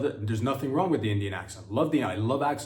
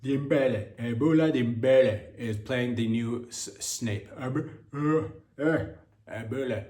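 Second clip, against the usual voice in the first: slow mock Nigerian accent on the names; lightly put-on Nigerian accent, stretching the names; a hint of a Nigerian accent; slower than usual. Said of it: "my Nigerian accent".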